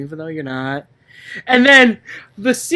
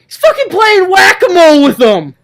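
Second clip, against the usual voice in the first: high, loud, laughing disbelief; incredulous outburst; 9 semitones higher, 7 dB louder than usual. Real, not acted. Describing Samson's fight to his friend, loud and incredulous. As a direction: blurt it out in amused disbelief.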